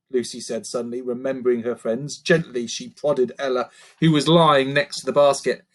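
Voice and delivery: usual speaking voice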